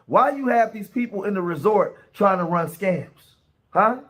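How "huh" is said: ordinary talk, nothing marked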